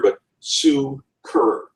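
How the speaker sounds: "Sue Kerr" slow, both syllables equally hit; two heavy beats; spondaic stress. Shown as a demonstration. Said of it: "That's spondaic".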